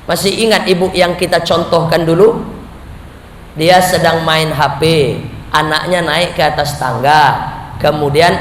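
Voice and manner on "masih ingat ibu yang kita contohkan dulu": ordinary talk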